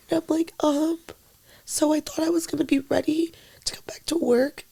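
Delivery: real crying, barely voiced; tearful whisper. Real, not acted. Breaking down on manager call.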